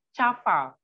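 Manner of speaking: "Cafa" said with a ch sound, as cha-fa; "Cafa" with ch at the start; Turkish spelling read out. Shown as a demonstration. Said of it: "kalau tulisan huruf Jawa seperti ini".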